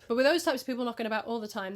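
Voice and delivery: ordinary delivery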